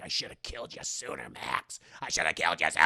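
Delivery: menacing hiss. Retold or imitated. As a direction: breathe the threat out coldly. Esther threatening Max.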